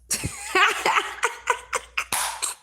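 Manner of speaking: breathy high-pitched burst of laughter; cracking up at the joke. Real, not acted. Hearing her father's saying about rich men, high laugh.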